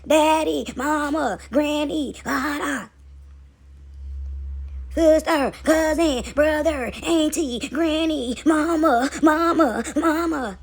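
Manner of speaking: mock bewildered calling, one by one; confused takers. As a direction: call out each one, lost and needy. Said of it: "they all confused".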